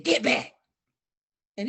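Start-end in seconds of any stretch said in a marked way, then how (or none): 0.0-0.5 sharp mock yell at the rooster; retold shout. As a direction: bark it out to scare him off. Yelling at the charging rooster.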